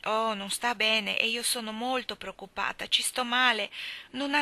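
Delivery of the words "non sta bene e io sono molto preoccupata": ordinary delivery, nothing marked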